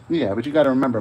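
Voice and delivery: normal talking voice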